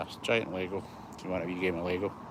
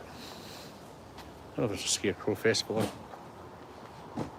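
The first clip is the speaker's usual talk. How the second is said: whispered aside; 6 dB quieter than usual. Real, not acted. Muttered aside while filming.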